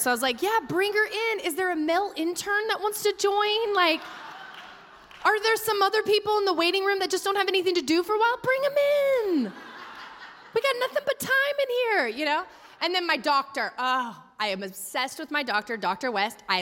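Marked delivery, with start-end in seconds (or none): 0.4-12.1 high, sing-song mock hospitality; sarcastic welcome. Mom in labor mocking the crowded room, sarcastic sing-song. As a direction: ham up the fake generosity. Sarcastic invitation to more observers.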